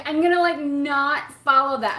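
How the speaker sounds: emphatic self-vow; determined. Retold or imitated. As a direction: swear off the habit firmly. Retelling own resolve, emphatic.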